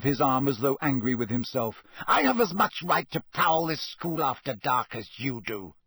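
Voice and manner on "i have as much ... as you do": higher, indignant hissing; tense, offended protest; hissed. A narrator's reading. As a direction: hiss your right defiantly. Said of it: "Snape hissed".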